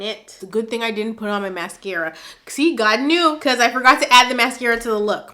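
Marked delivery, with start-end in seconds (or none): 2.5-5.3 loud, fast, laughing through tears; loud tearful joke. Real, not acted. Vlogger tearing up over an email, loud tearful quip. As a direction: blurt it out while fighting tears. Crying while joking about mascara.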